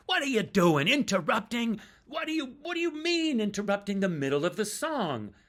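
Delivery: loud, high mock outrage; pretend indignation. Bandmate faking anger at the interruption mid-song, loud and high. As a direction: ham up being interrupted. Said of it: "pretend to get angry and indignant".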